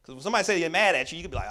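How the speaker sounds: usual speaking voice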